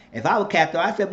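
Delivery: ordinary talk, nothing marked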